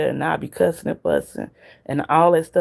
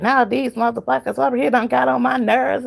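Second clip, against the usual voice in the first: imitating the lady; 8 semitones higher than usual. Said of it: "She like".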